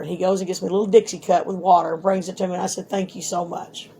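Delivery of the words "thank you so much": quiet, slow grateful words; soft thanks. Retold or imitated. Daughter thanking the kind jailer, quiet and slow. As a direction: breathe out a small thank-you.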